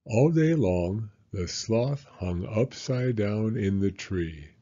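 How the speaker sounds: gentle storybook narration; reading to children. A narrator's reading. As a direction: read it cosily to little ones. Reading picture book to children.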